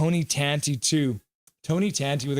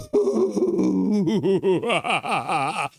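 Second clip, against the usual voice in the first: laughing; 3 semitones higher than usual. Real, not acted. Laughing after the big whoop.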